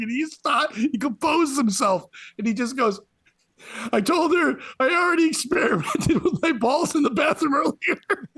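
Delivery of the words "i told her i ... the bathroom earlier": high, giggly retelling; high kid-like delivery breaking into a laugh; imitated laughing boy. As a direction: squeeze the punchline out through laughter. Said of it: "laughing so hard that he can't get the words out".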